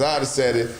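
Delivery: ordinary talk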